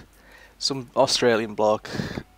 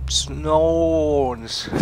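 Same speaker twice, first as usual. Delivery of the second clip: bad Australian accent; 4 semitones higher, 3 dB louder than usual. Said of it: "I can't do the Australian accent very well".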